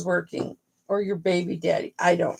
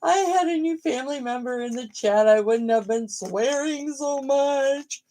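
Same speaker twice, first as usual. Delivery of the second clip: mock prim apology; 5 semitones higher than usual. Streamer apologising to new chat member, prissy higher voice. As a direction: play the proper lady who would never swear. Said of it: "I was like".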